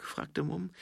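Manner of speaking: usual speaking voice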